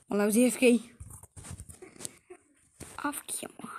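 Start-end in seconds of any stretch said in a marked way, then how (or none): 3.0-3.7 secretive whispered aside. Real, not acted. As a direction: whisper it to the viewers. Sly aside to viewers.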